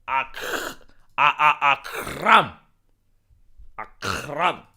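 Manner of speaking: "Akram" with a long rasped guttural k; throaty Arabic kh. Shown as a demonstration. Savouring the Arabic name, "Akram" with an exaggerated throaty k. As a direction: scrape the k of "Akram" deep in the throat. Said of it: "they say the h".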